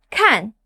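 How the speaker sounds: "Kan," dropping fast, short and sharp; quick falling "Kan,"; fourth tone. Shown as a demonstration. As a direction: drop the pitch sharply through "Kan,". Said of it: "The fourth tone drops fast".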